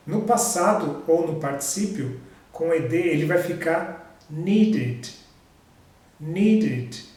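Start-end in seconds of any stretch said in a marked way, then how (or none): 4.3-7.0 "needed" in two clear syllables, need-id; ED as extra syllable. Shown as a demonstration. Teacher modelling the second rule, "needed" with an added syllable. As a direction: add a separate "id" syllable to "needed". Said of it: "como se fosse acrescentado uma outra sílaba".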